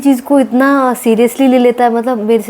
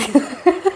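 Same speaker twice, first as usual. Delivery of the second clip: giggle; 6 semitones higher, 4 dB quieter than usual. Real, not acted. Giggles about husband's singing.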